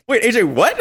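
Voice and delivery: high, loud mock-shocked question, half laughing; stunned disbelief. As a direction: act out being floored by the news. Retold stunned reply to match news.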